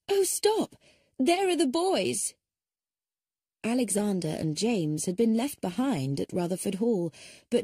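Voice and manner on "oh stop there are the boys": sudden high cry, much higher and louder; cried out. A narrator's reading. Emma spotting the boys as the car leaves, high excited cry. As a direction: Emma calling the car to halt for the boys, crying out. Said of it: "Emma cried".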